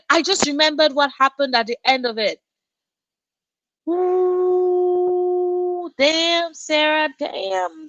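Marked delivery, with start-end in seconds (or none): 3.9-7.9 high, drawn-out knowing ooh. Real, not acted. Streamer warning Sarah about the book's ending. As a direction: tease the viewer about the pain ahead. Reacting to a viewer's book.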